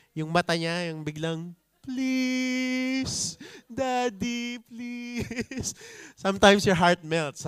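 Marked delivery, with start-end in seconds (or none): 1.8-5.7 put-on high, pleading little-kid voice; begging child. Child begging daddy with puppy eyes, high and pleading.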